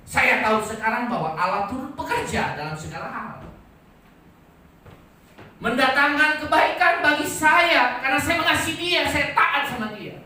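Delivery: high, loud proclamation of faith; impassioned, ringing sermon voice; full-force preaching. Acted. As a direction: declare it to the whole congregation.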